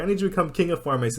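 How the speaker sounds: speedy upbeat command; quick high order. Retold or imitated. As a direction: rattle the order off fast and high. Rimuru gives sudden order.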